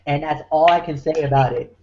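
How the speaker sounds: usual speaking voice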